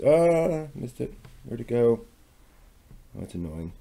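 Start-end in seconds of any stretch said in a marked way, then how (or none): none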